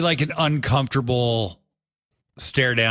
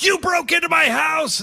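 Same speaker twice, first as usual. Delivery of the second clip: acted Hangman accusation; 14 semitones higher than usual. Hangman yelling at Swerve about the break-in. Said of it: "the Hangman goes".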